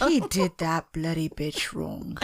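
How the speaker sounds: slow, lightly put-on British accent; mock British accent. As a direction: hammer out a mock British line slowly. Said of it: "Try and do a British accent and say that".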